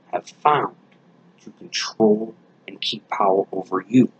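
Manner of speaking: hushed, ominous whisper; dramatic whisper. Real, not acted. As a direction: whisper the warning. Dramatic warning about control.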